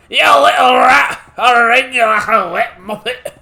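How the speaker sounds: put-on loud angry Scottish accent; Scottish accent shout. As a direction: yell it in a broad Scottish accent. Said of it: "the British Dark Lord who turned Scottish".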